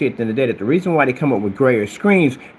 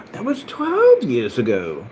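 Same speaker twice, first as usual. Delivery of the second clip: gasp, then drawn-out higher disbelief; mock astonishment; 5 semitones higher, much slower than usual. Boasting to rivals about old demo, mock astonished. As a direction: stretch it out in put-on amazement. Feigns shock at old video date.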